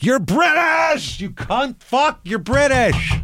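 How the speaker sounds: high-pitched exasperated outburst, words stretched; outraged yelling. Acted. Host berating the British actor, high outraged rant. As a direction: rail at him, voice shooting way up.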